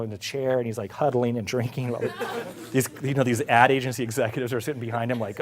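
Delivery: usual speaking voice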